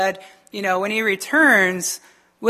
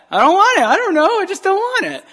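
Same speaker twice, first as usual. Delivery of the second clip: flustered refusal; 10 semitones higher, 5 dB louder than usual. Retold or imitated. His flustered refusal retold.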